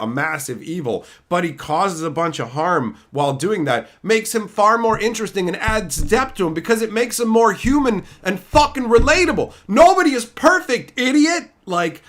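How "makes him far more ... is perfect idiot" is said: rising into a loud, high yell; building angry rant. Real, not acted. Ranting at modern fans, building to a shout. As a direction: build from heated to furious shouting.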